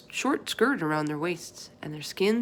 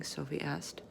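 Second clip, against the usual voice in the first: ordinary talk, nothing marked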